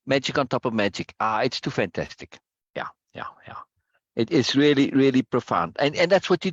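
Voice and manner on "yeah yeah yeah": soft whispered agreement; whispered aside; whispered yeah. Real, not acted. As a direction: breathe the yeahs under your breath. Quiet aside.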